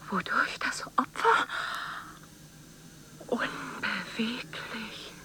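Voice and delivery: hushed voiceless whisper, slowing at the end; creepy nature-film whisper; eerie whisper. Acted. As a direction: breathe it like a secret. Held whispered narration.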